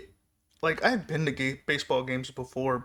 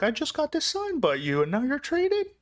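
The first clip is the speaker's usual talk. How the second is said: high, incredulous whine; mock disbelief; 13 semitones higher than usual. Fan to the traded player, high mock disbelief. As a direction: pitch it way up in fake shock.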